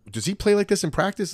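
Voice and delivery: high-pitched, questioning coach; lightly voiced coach. Coaches watching film of a player, lightly acted query. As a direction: lift the pitch for an intrigued coach.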